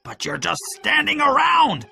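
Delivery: very high exasperated yell; Pew shouting. A narrator's reading. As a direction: yell at the men for idling. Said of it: "Pew shouted".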